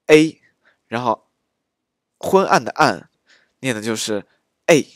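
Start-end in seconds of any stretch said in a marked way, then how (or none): 0.1-0.4 A said loud and clear, a touch higher; louder dialect vowel demo. Shown as a demonstration. Teacher drilling students on the dialect vowel, called out loud. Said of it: "安全的安发的就是".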